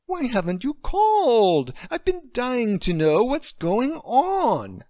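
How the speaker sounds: high, loud and slow, eager; can't wait to hear the news; overanimated. Acted. Friend on the phone demanding news, overanimated. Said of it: "Lacey's overanimated voice".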